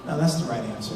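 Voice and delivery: very low, soft reply; retold quiet reassurance. Interviewer confirming the new hire's answer, quiet reassurance. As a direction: answer low and softly, as settled fact.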